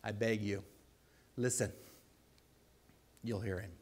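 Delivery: usual speaking voice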